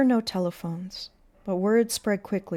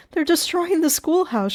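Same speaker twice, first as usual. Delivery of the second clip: high, loud, urgent report; panicked and raised in pitch; gasping alarm; 10 semitones higher, 8 dB louder than usual. A narrator's reading. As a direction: burst out with the alarm. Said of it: "gasping for breath".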